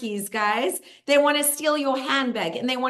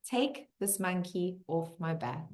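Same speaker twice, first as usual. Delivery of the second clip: soft, low closing release; quiet surrender; 3 semitones lower, 9 dB quieter than usual. Shown as a demonstration. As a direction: say it gently, letting it go. Closes with the release phrase.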